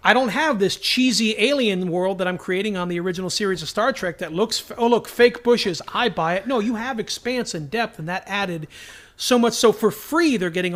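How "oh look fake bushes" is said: ordinary talk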